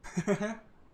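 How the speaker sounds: short, high rising chuckle; short laugh. Real, not acted. Gamer admits fearing the opponent, amused chuckle. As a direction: break into a brief real laugh.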